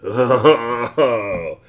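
the bug suddenly realizing his hunger, gasping. Acted. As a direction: catch your breath on it. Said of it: "gasped the bug".

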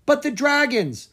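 high, loud protest; yelled protest. Acted. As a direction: yell back in alarm. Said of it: "Someone yelled".

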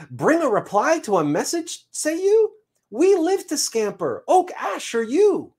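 very high, animated squirrel chirp; eager squirrel messenger. Acted. Squirrel takes the seed's message, eager chirp. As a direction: pipe up high like a scampering squirrel.

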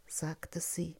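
plain reading voice

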